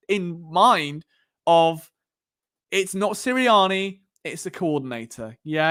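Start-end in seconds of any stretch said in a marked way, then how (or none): none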